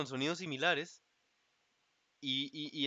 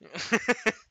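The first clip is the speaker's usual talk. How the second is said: high, loud laugh at oneself; short burst of laughter; 9 semitones higher, 8 dB louder than usual. Real, not acted. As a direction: burst out laughing after your own impression. Laughs at own retold swearing.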